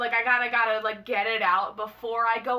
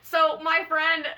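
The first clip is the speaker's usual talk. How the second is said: ordinary delivery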